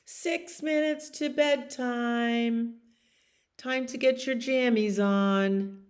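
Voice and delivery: dad calling out bedtime. Acted. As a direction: call it through the house at bedtime. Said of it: "And Daddy calls out".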